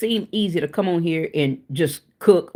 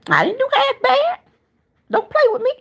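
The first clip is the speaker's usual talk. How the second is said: playful squeal. Real, not acted. Bragging about rolls, squeaky.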